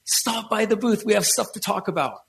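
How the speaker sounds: Nicola inviting. Retold or imitated. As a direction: invite him over warmly.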